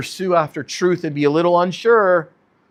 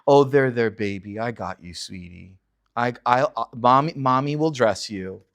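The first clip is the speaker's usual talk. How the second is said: put-on baby-talking mother; 4 semitones lower than usual. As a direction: croon it like a mother to a toddler. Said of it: "treated him like a little toddler".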